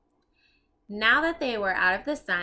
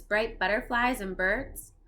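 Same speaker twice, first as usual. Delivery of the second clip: usual speaking voice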